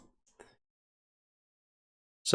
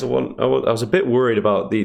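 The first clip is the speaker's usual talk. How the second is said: ordinary talk, nothing marked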